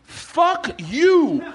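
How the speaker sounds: high, shrieking retort through a laugh; hammed-up high squeal of fury; mock Chappelle yell. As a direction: fire it back like an outraged comic. Chappelle voiced yelling at heckler.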